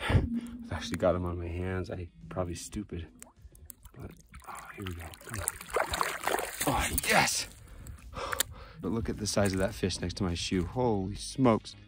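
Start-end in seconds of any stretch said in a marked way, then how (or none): none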